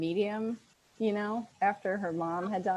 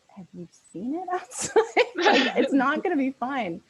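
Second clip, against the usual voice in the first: laughing disbelief; 6 semitones higher, 6 dB louder than usual. Retold or imitated. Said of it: "the rest of us are like".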